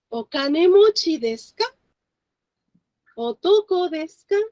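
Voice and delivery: "Okanemochi desu ka? Otoko desu ka?", each climbing at "ka"; rising question intonation. Shown as a demonstration. Teacher quizzes all the students, rising question intonation on each "ka". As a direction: lift each final "ka" into a clear question. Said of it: "with the intonation going up so that it makes a question".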